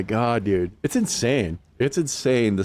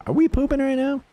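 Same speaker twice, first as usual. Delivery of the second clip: high silly voice; faster than usual. Pretend. Talking to game characters, high voice.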